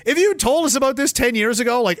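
ordinary talk, nothing marked

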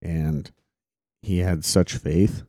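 usual speaking voice

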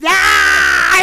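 loud low mock pain yell; mock scream of pain. Imitating a wrestler screaming from punches, loud mock yell. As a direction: howl like boiling oil hit you. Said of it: "He's just".

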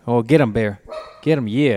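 loud cheering on the dog; calling to the dog. Real, not acted. Urging the dog on.

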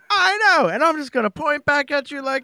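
high, hammy overacting voice; mock actor overacting. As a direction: ham it up like a bad actor. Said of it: "and lots of".

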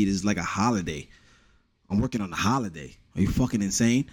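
usual speaking voice

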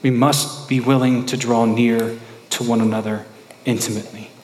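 ordinary delivery

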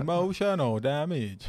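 slow exaggerated accent; meme catchphrase voice. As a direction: drag out Emotional damage.

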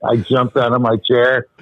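usual speaking voice

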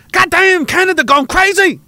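high put-on Chinese accent; mock Chinese accent. Chinese soldier watching Canada mid-war, high mock Chinese accent. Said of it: "Everybody's doing Chinese accents now".

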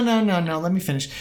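ordinary talk

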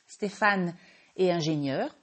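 ordinary talk, nothing marked

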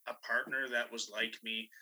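ordinary delivery

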